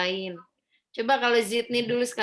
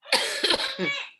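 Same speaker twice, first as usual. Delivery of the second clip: a dry real cough. Real, not acted. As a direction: cough sharply. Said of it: "Saya lagi batuk soalnya".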